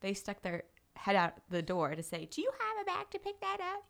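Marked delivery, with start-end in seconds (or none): 2.3-3.8 high, snooty mock neighbor voice. The neighbor at the door demanding she pick up the poop, high snooty voice. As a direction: mimic the neighbor's prim demand.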